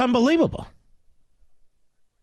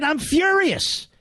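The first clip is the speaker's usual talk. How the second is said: slow, higher, furious declaration; 9 semitones higher, much slower than usual. Real, not acted. Host to listeners, insisting he is furious, not frustrated. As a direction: spit it out, truly furious. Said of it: "I'm not frustrated".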